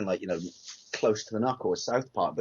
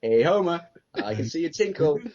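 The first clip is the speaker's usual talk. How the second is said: higher, lightly put-on cheery neighbour; Flanders; 5 semitones higher, 4 dB louder than usual. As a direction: chirp a mock friendly hello. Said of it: "you just hear Flanders go".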